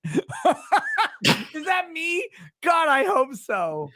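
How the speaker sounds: high, slow laughing question. Real, not acted. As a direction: laugh it out delightedly. Laughs at Munster insult.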